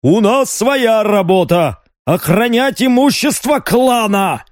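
high, stern barked order; stern growl. A narrator's reading. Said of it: "грозно рыкнул на него второй".

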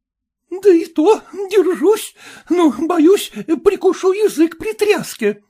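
very high female-character voice; high-voiced, anxious wife; fretful wife. A narrator's reading. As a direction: complain nervously in a high pitch. Wife answers, afraid on jolting cart.

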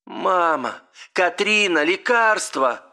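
high-pitched dream call; crying out in sleep. A narrator's reading. Dreaming woman calls her mother and Katrina, crying out. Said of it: "Ты кричала во сне, звала".

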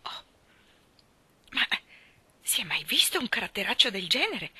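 breathless, whispered shock; horrified exclamation. Acted. Rachel Lynde whispers her horror at Anna's temper. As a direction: gasp it out, appalled. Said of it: "esclamò inorridita".

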